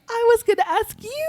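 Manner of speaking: delighted Filipino lady. Retold or imitated. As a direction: imitate a tickled Filipino woman. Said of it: "she just starts laughing".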